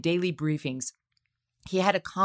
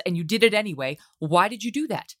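usual speaking voice

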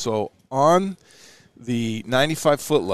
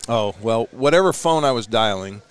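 usual speaking voice

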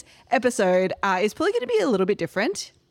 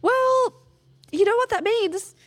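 much higher, louder gleeful announcement; excited; 13 semitones higher, 4 dB louder than usual. Retold or imitated. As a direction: proclaim it like a giddy reveal. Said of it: "And so I was like".

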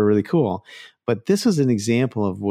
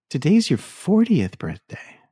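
pointed remark; 8 semitones higher, slower than usual. Retold or imitated. Agent voiced, pointed remark.

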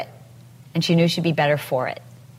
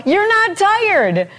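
slow, much higher, louder coaching yell; 12 semitones higher, 4 dB louder, much slower than usual. Shown as a demonstration. Fitness instructor yelling encouragement at viewers, slow and loud. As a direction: shout it slowly like a workout coach pushing the class. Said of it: "So instead I yell".

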